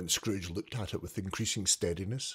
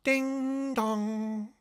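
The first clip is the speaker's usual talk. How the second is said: high ringing ding dong like a clock bell; bell chime; 13 semitones higher than usual. A narrator's reading. Narrator imitating the clock bell, high ringing chime.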